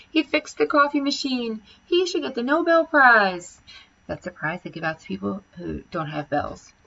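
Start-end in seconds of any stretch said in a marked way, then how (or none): 0.1-3.6 higher, slow gushing praise; excited cheering. A narrator's reading. Teachers praise the janitor, high and gushing. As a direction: gush like a cheering crowd. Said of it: "they yelled".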